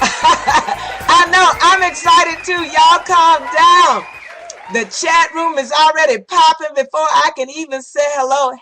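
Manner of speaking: loud, sassy diva hype; campy drag queen. Acted. Campy host shouting over crowd.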